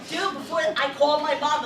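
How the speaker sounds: ordinary delivery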